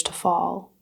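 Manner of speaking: calm meditation guide, long unhurried pauses; guided meditation. Real, not acted. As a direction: lead them gently through each breath, pausing between phrases.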